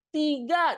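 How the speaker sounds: high, loud Tiga in two beats as ti-ga; counting aloud. Shown as a demonstration. Teacher counting in Indonesian for parents, loud and high. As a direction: call out Tiga, splitting ti and ga. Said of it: "Tapi begitu kita ngitung bahasa Indonesia, coba".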